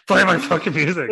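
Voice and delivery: loud, high shouted demand; angry yell. Acted. Vampiro yelling at the barista for his music. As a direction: bellow it like a furious wrestler.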